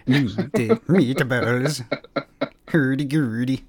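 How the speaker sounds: high, slow goofy Swedish Chef voice; hammed-up Swedish Chef gibberish. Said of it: "going to the Swedish Chef".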